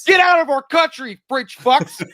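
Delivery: high, loud mock-outraged yell; joking furious outburst; mock angry shout. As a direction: yell it as a hammed-up xenophobic rant. Mock outburst at Cajun people.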